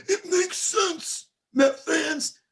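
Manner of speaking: mocking Susan Waldman. Host mocking the Mets announcer to listeners, high sing-song parody. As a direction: parody her drawn-out, lilting on-air delivery. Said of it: "Susan Waldman came on and went".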